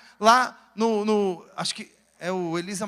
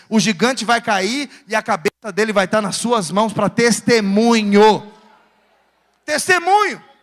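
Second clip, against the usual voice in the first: loud, emphatic pulpit delivery; thundering preacher, punching key words; forceful preaching; 8 dB louder than usual. Acted. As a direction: build to a triumphant shout for the congregation.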